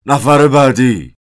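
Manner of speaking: high, loud, drawn-out counter call; shouted call. A narrator's reading. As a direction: yell it out slowly to the queue. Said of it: "گارسون از پشت پیشخوان فریاد زد". (فریادِ بلند و کشیدهٔ گارسون)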